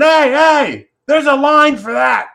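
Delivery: high, loud yell across a lot; retold scream. Guy across the parking lot yelling at him, loud shout. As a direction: bellow at someone far off who cut in. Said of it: "starts screaming".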